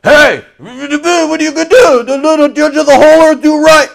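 high, loud mock confrontation; brash challenge. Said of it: "Notice how he went from".

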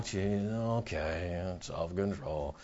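grudging mutter. Retold or imitated. Mocking the congregation's reluctant reaction.

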